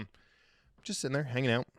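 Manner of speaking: normal talking voice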